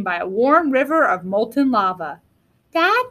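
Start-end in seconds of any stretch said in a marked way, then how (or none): 2.7-3.1 high questioning little dinosaur; Rex. A narrator's reading. Little dinosaur to his dad, high questioning call. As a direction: ask for dad's attention like a child. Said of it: "said Rex".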